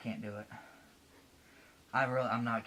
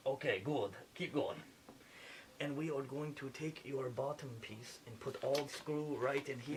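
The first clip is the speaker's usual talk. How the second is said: lightly put-on French accent playing a surgeon; a French surgeon narrating the operation, a hint of French accent; fake French accent; 2 semitones lower, 8 dB quieter than usual. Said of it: "going back to the accent".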